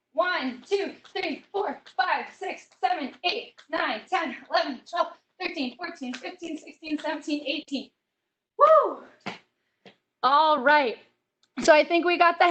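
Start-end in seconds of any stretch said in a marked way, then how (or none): 0.1-7.9 softer, breathy count mid-exercise; counting reps. Real, not acted. Instructor counting butt kicks with the class, winded count. 8.6-9.0 high, loud cheer; excited whoop. Real, not acted. Coach celebrating the finished butt kicks, loud whoop.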